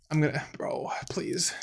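very soft, defeated whisper; hushed, pleading aside; whispered plea. Real, not acted. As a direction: whisper it in embarrassed dread. Embarrassed aside, dreading being flamed.

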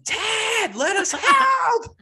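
high, slow shouted plea through a door; pleading shout. Pretend. PR team yelling through Ted's locked door, high pleading shout. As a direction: call out to him to let them in. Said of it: "banging on the door like".

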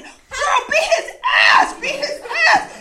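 high loud chant from the crowd; cheering on a street fight. Retold or imitated. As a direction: egg her on like a rowdy crowd. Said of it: "throwing money like".

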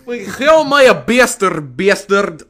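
put-on German accent. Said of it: "my German accent sounds better".

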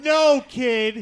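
put-on scolding a kid through laughter; laughing mock scolding. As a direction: scold the kid while cracking up. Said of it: "And I was like".

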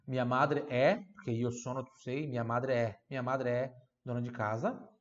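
usual speaking voice

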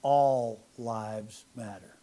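slow deliberate pronouncement; mock-solemn slow declaration; retold yell, slow and low. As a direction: lay each word down slowly. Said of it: "I said".

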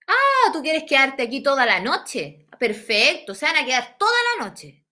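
imitando al paco, fuerte y con energía; policía prepotente. Retold or imitated. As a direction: amenaza burlona de policía imitado. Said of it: "dijo el loco así, el Paco". (policía prepotente, con sorna y en voz alta)

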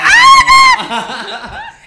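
high, loud laughing squeal; excited shriek. Real, not acted. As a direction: burst out in a delighted shriek. Getting the photo after all.

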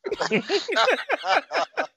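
high burst of laughter. Real, not acted. Laughing after joke.

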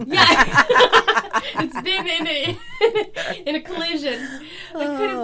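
high, loud laughter breaking the words; laughing. Real, not acted. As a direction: gasp out the words through laughter. Laughing at the Carfax joke.

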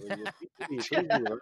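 high, loud, drawn-out plea; mock pleading. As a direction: act out shooing someone off. Re-enacting shooing strangers away.

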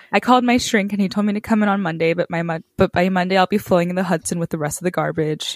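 fast, frantic nervous gabble; rapid-fire panic. Retold or imitated. Quoting Jamie's frantic wedding-day patter, fast and breathless. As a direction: rattle it off in one panicked breath. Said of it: "talking in a million words a minute".

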